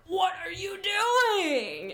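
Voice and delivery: high, slow, delighted shout; excited shout. Retold or imitated. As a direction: call it out in thrilled disbelief. Excited reaction to a punk.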